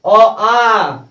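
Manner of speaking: loudly called out vowels; teacher calls vowels out loud; loud recitation. Shown as a demonstration. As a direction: call out the vowels to the class. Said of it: "अब कैसे पढ़ते हैं?".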